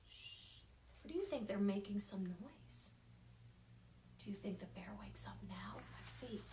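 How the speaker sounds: soft unvoiced whisper. A narrator's reading. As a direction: hush the children, whisper it. Quiet reading near sleeping bear.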